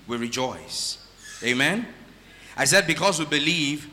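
normal talking voice

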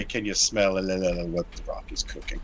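low, soft Rock catchphrase; lightly voiced wrestler growl; imitating The Rock. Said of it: "And then he'd say".

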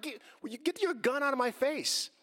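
high, agitated demand; retold indignant protest. Driver to officer with gun in his face, indignant high protest. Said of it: "I'm like".

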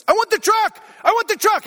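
high-pitched mock child yell; yelling kid. Son demands a toy from dad in Target, yelling. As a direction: imitate a little boy's insistent high holler. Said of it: "the kid that's yelling".

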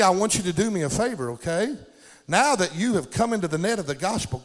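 normal talking voice

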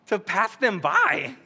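puzzled, rising incredulous tone; mock disbelief. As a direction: question it as if it makes no sense.

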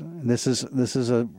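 usual speaking voice

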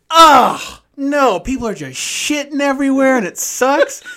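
high, loud mock dad recoiling in disgust. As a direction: ham up the dad's revulsion at old movies. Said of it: "He goes".

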